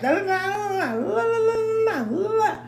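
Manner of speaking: high sing-song la la la mimicking a nagging parent; mock the endless yelling as meaningless babble. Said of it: "how parents just keep on yelling".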